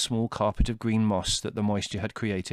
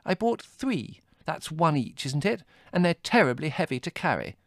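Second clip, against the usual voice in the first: high-pitched, exasperated scolding; irritable; 6 semitones higher than usual. A narrator's reading. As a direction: scold testily in a raised pitch. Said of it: "said Margot irritably".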